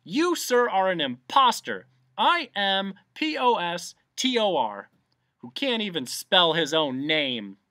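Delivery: high, loud outraged denunciation; very excited shout. A narrator's reading. Bee denouncing the humbug as impostor, excited shout. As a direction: denounce loudly, spelling with emphasis. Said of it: "shouted the bee very excitedly".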